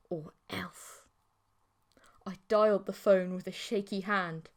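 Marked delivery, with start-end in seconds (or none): 0.1-1.0 sudden soft threatening whisper; menacing whisper. A narrator's reading. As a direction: drop to a creepy whisper.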